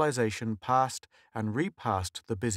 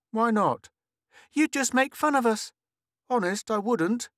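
put-on arguing kids; 12 semitones higher, 4 dB louder than usual. Children arguing.

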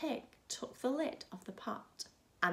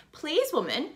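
higher, louder little pig; lightly voiced piggy plea; a hint of a squeaky pig asking politely; 7 semitones higher, 8 dB louder than usual. Pig asks for sticks.

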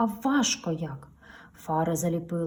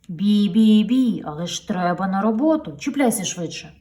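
louder hurrying truck honks and urges; urging; 2 semitones higher, 9 dB louder than usual. Acted. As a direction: hurry the trailer along. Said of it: "підганяє ваговіз". (підганяє)

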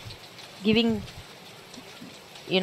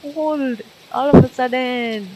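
high, tense and rattled; spooked, voice jumping up; scared; 6 semitones higher, 4 dB louder than usual. Real, not acted. Streamer spooked by cold goosebumps, high and scared. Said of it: "I'm scared".

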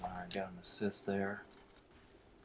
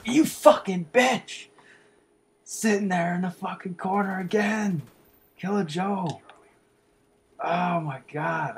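unleash it on the camper; screaming rage; 9 semitones higher, 11 dB louder than usual. Real, not acted. Gamer screams at Killer Joe hiding in the corner, raging. Finds camper behind counter.

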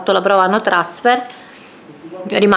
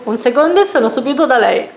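catchphrase voice; 5 semitones higher, 4 dB louder than usual. Retold or imitated. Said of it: "chi era poi che diceva così?".

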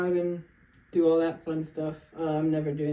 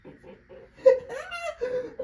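crying laugh. Real, not acted. After pain, laughing crying.

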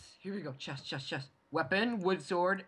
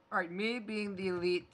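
ordinary delivery

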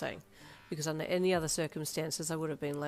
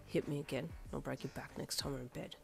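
very soft, low threat; low, intimate growl; 3 semitones lower, 8 dB quieter than usual. A narrator's reading. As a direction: murmur a playful threat into the ear. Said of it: "he growled into your ear".

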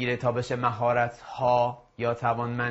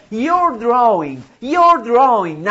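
very slow, louder and higher, your drawing stretched; slow model phrase; 7 semitones higher, 8 dB louder, much slower than usual. Shown as a demonstration. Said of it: "به انگلیسی چی میشه؟". (آهسته و بلند: your drawing)

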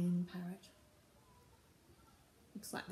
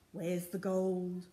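ordinary delivery

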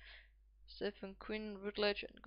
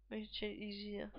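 normal talking voice

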